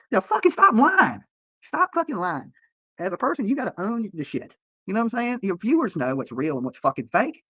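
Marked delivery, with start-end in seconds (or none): 0.1-2.5 high, drawn-out exasperated outburst; exasperated rebuke. Real, not acted. Telling off YouTubers who claim not to care, exasperated. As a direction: call out liars, pitch jumping up.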